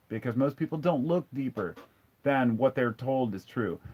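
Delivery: usual speaking voice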